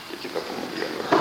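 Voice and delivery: whispered aside to the class, like a secret; συνωμοτικός ψίθυρος. Real, not acted. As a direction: lean in and whisper it. Tells students a secret. (ψιθυριστά, σαν μυστικό)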